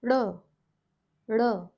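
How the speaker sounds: "R" said with tongue curled in, clicked out on the teeth; rolled r. Shown as a demonstration. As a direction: curl the tongue in, then flick "R" forward. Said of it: "It sounds like".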